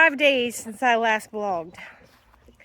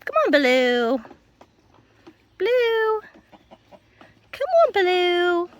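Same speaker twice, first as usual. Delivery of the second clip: high, slow coaxing call; slow high pet call; 6 semitones higher, slower than usual. Real, not acted. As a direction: draw the dog in gently. Calling dog.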